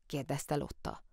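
usual speaking voice

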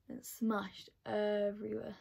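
slowed down, each word weighted, "everywhere" drawn out; slow dramatic retelling. Real, not acted. Telling viewers her highlighter broke, slow and dramatic. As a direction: let "It smashed everywhere" sink in slowly.

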